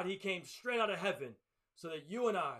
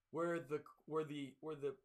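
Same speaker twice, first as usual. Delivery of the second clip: usual speaking voice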